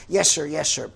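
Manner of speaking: loud, crisp military yes sir; barked sir yes sir. Retold or imitated. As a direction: bark it like a soldier answering. Lieutenant answers.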